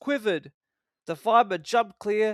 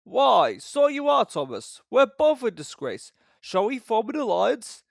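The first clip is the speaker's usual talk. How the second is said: Gordon hearty; 6 semitones higher, 5 dB louder than usual. A narrator's reading. Gordon proposes.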